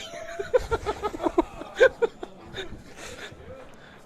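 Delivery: helpless laughter at own joke; long laugh. Real, not acted. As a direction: break up laughing, high and airy.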